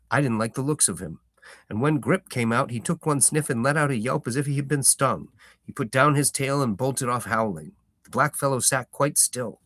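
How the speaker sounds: storyteller reading a novel aloud; story narration. A narrator's reading. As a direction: tell it like a fireside tale.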